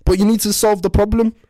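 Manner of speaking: high, loud, upset put-on Scouse voice; distraught wife. Wife begs husband for help, high distraught voice. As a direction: play the wounded wife, pitched high and loud.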